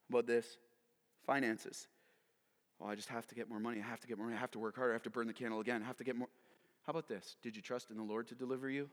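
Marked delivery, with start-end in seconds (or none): 2.8-6.3 low, quick, repetitive worrying; fretting to oneself. Acted. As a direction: run through the worries under your breath. Acted quote of money worrier.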